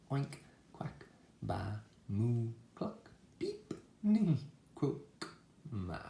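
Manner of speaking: quick-changing storybook menagerie; animal noises. A narrator's reading. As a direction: imitate each farm animal in turn. All the animals sound off aboard.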